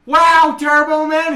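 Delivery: put-on high, loud kid amazement; mock starstruck child; awestruck boy. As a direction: gush like an amazed little boy. Acts awestruck boy in film.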